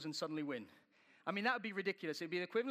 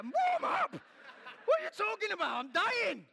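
much higher, much louder, strained squeal of disbelief; exasperated shriek; 9 dB louder than usual. Retold or imitated. [name] acting his reply to Chris mid-ride, shrieking disbelief. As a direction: squeal like you're about to collapse.

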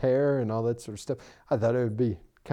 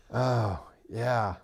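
slow, drowsy mumbled reply; imitated groggy sleeper. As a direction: answer as if barely awake. Imitating friend still half asleep.

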